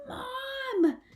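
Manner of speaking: high cry of alarm; shout. Acted. Izzy yelling for her mother in panic. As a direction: yell for mom in a panic.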